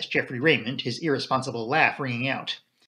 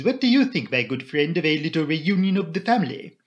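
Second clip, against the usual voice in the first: put-on accented Belgian detective, lively; higher-pitched, courteous foreign sleuth; Poirot; 3 semitones higher, 3 dB louder than usual. Poirot asks a friend about a family reunion, accented and lively.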